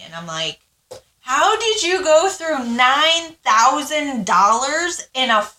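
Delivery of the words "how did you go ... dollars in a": high, loud, slowed-down outraged yell; furious yell. Retold or imitated. Wife yelling at husband who blew the tax refund, furious high yell. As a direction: yell it at him in disbelief. Furious at ex over spent money.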